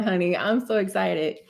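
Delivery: normal talking voice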